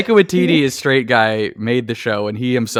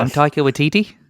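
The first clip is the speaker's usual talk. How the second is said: Taika Waititi; 4 semitones higher than usual. Acted. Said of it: "like, Cockney at that point".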